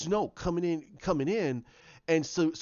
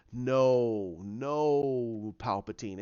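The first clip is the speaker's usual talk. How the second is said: long, low drawn-out noes; lightly acted condescending dark master; retold villain's rebuke; 4 semitones lower, much slower than usual. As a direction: draw out each no, sinking lower. Snoke rebukes Palpatine, retold.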